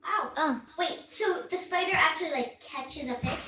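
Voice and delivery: talking with tongue stuck out; tongue sticking out. Real, not acted. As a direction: say it with your tongue out. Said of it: "My tongue is out".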